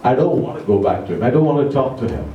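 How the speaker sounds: ordinary delivery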